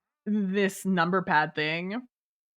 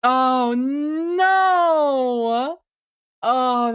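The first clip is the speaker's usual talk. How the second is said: loud, higher cry of dismay; 4 semitones higher, 5 dB louder, much slower than usual. Real, not acted. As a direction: belt out an outraged oh no. Reacting to awful review.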